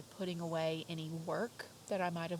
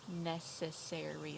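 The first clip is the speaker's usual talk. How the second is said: "Necessary" said slowly, syllable by syllable; slow syllable demo; 2 semitones lower, slower than usual. Shown as a demonstration. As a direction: model "Necessary" slowly for the class. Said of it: "say necessary".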